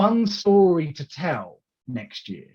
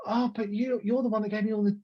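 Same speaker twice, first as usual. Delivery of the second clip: higher, softer mock fluster; lightly hammed flustered deference; mock flustered reply; 7 semitones higher, 3 dB quieter than usual. To his former boss, mock flustered reply. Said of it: "I'm looking at him going".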